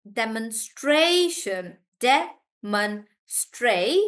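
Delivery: demonstration slowly, STRA stressed; stress before "shun". Shown as a demonstration. Teacher breaking down demonstration, slow with stra stressed. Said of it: "we stress on the one before".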